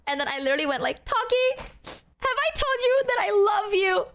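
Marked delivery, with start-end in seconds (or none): 1.0-4.0 put-on high, whiny drunk affection; hammed-up tipsy love declaration; drunken gushing. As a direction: gush it like a clingy drunk friend.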